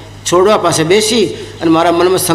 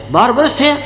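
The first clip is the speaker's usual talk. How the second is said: higher astonished echo of વર્ષ; surprised guru; 5 semitones higher than usual. Acted. Guru echoing the disciple's answer, surprised and higher. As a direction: repeat it back in disbelief.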